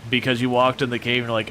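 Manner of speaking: ordinary delivery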